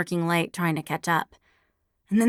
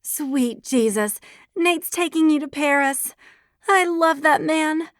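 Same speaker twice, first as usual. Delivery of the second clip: gushing; 9 semitones higher, 4 dB louder than usual. A narrator's reading. Gushing over boyfriend's Paris trip.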